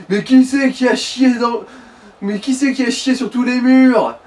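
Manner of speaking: high, loud, slowed indignant bellow; mock furious yelling. Friend acting out prank victim's reaction, furious mock yell. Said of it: "il pourrait juste gueuler".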